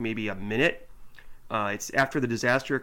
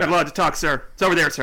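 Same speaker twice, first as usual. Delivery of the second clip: stiff military cadet; 4 semitones higher, 8 dB louder, faster than usual. Retold or imitated. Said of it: "kids won't even talk".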